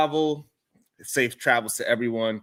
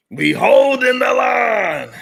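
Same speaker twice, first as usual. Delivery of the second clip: higher, louder, slow rallying shout; 10 semitones higher, 9 dB louder, slower than usual. Shown as a demonstration. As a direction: bellow it like a battle cry.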